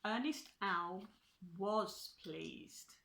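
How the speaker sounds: ordinary delivery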